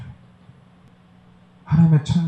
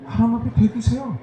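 urgent plea; 12 semitones higher, 3 dB quieter than usual. Real, not acted. Preacher urging defiance.

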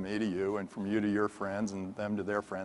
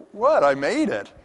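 higher and louder, indignant; surprised protest; 8 semitones higher, 7 dB louder than usual. Retold or imitated. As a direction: call back in startled disbelief. Reacting to partner's shout.

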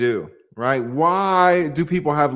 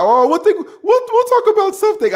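imitating a friend's accent, much higher pitched; a quick put-on accent, voice jumping way up; Ike's accent; 4 dB louder than usual. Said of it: "I can't do a, his accent".

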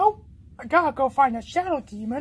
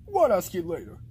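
ordinary delivery

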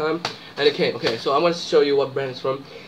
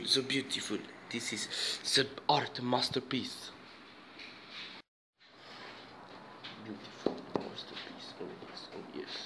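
soft murmur between bites, like ASMR; quiet savoring murmur; 2 semitones lower, 13 dB quieter than usual. Real, not acted. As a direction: mumble your delight to yourself, softer. Murmuring while savoring food.

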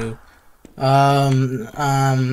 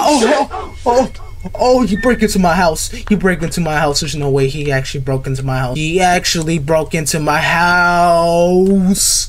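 panicked disbelief; 3 semitones higher than usual. Real, not acted. Player finding a break-in, panicked disbelief. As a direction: gasp out the shock.

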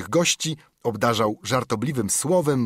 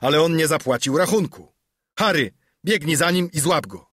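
loud order; 6 semitones higher, 4 dB louder than usual. A narrator's reading. Silver orders chase after debtor.

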